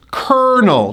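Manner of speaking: "kernel" in two syllables, KER-nul; model pronunciation. Shown as a demonstration. Teacher shows how the word is pronounced, "kernel" as KER-nul. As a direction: stress the first syllable of "kernel". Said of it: "We pronounce this word as".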